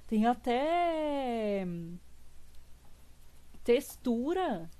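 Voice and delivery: very slow, drawn-out admiring words; awed marvel. Real, not acted. Streamer admiring a viewer's drawing, slow awed marvel. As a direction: savour each word in amazement.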